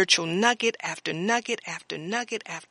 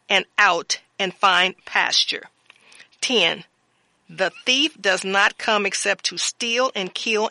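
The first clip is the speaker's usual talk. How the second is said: louder, measured sermon reading of scripture; 5 dB louder than usual. Acted. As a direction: proclaim the verses to the congregation.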